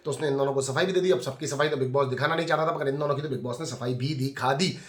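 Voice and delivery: ordinary talk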